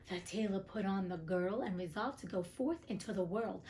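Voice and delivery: plain reading voice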